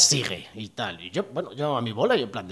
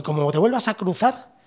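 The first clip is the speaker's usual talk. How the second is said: threatening cop; 8 semitones higher, 7 dB louder than usual. Retold or imitated. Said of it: "Y me dijo".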